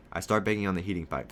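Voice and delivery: plain reading voice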